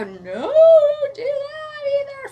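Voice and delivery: the body pleading in distress; body's panicked cry. Retold or imitated. Retelling her body's reaction to the medication, wailing. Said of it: "causes my whole body to go".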